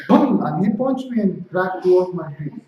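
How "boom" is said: loud, high "boom" for a blow; imitated punch.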